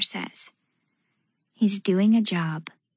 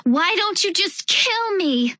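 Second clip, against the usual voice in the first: sudden rage; 10 semitones higher than usual. A narrator's reading. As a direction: explode with rage. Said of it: "my body filled with a sudden rage".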